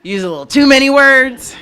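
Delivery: high, loud burst of self-mockery; joking exclamation. Real, not acted. Poet teasing herself for being wordy, joking exclamation. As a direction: blurt it out loud and high, poking fun at yourself.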